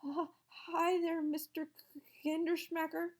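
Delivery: high, slow, stammering greeting; fake nonchalance. A narrator's reading. Scared teacher greeting his old teacher, stammering high. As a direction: try to sound casual and fail. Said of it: "tried to sound nonchalant".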